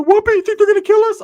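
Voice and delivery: high, loud frightened squeal; mock panic. Ricky hiding in bushes, high panicked question.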